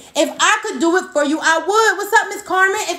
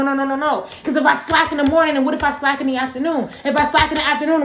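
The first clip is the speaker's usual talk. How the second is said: normal talking voice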